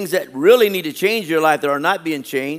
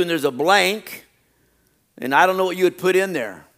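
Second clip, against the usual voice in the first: ordinary talk, nothing marked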